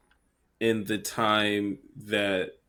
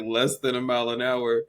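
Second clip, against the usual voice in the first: normal talking voice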